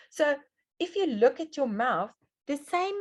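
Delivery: ordinary delivery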